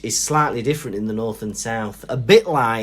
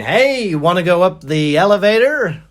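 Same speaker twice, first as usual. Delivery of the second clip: put-on loud, high, brash American accent; hammed-up American calling out an invitation; 4 semitones higher, 7 dB louder than usual. American approaching a stranger, loud American accent. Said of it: "like me being American".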